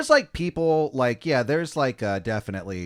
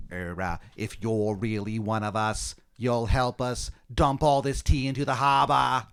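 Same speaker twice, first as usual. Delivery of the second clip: put-on awful Boston accent. Colonist recruiting you to dump tea, bad Boston accent. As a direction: start slow, build in fake Bostonese. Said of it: "he says in that awful accent".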